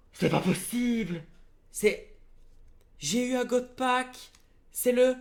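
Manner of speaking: loud, high-pitched disbelief; real shock, voice leaping up; stunned excitement. Real, not acted. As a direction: cry it out, overwhelmed by a lucky pull. Discovers a rare god pack.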